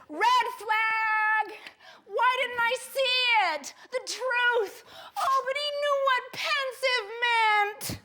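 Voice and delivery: hammed-up wail, pitched well up; exclaimed alarm. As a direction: cry out each realization. Belated alarm at herself.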